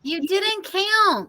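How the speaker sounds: high, slow mock shout. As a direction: stretch it out as if shouting over noise. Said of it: "And she shouted".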